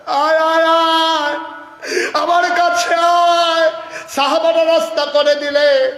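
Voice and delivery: high, loud anguished outcry; full-force preaching. Acted. Prophet calling his starved companion to him, high wailing shout. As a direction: call out to a wasted, beloved companion.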